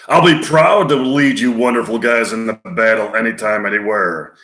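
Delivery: loud general's rallying speech; forceful military oratory; George C. Scott as Patton. Acted. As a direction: address the troops like a proud commander. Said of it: "That was from, uh, the movie Patton".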